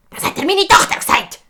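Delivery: high, loud, raspy witch cry. Acted. The old woman accusing the king, high witch screech. Hag snaps back.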